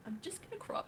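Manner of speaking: very high put-on voice; exaggerated high-pitched caricature; mocking impersonation. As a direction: squeak it out in a mock streamer voice. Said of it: "I can't do it anymore".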